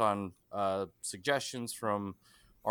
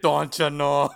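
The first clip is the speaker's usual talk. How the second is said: louder, higher, folksy Canadian-style lilt; jokey put-on accent close to Canadian; mock near-Canadian accent; 4 semitones higher, 9 dB louder, slower than usual. Host's Boston attempt drifts off, mock near-Canadian accent. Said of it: "they're so close to Canadian".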